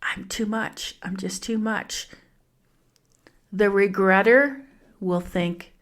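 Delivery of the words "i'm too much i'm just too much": soft, small, self-doubting voice; scared and small. Acted. The Scared and Small character doubting herself, small and hushed. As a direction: shrink it down, much softer, as if timid. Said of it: "The Scared and Small will just go".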